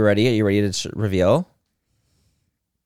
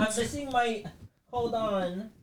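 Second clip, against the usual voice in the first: panicking voice; 8 semitones higher, 13 dB quieter, slower than usual. Pretend. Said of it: "You're panicking your voice".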